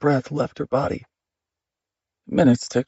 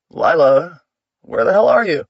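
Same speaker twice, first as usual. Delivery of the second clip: loud call rising higher; angry shout; 3 semitones higher, 7 dB louder than usual. A narrator's reading. Husband searching the big house for his wife, angry shouting. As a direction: yell through the house, demanding.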